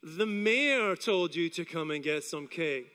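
low, slow, sceptical echo; doubtful neighbours. Acted. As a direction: repeat it back slowly in disbelief. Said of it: "they're gonna stand there and go".

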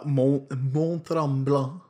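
slow, exaggerated Quebecois pronunciation; Quebecois accent. Shown as a demonstration. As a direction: draw out the Quebecois French name. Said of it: "in Quebecois".

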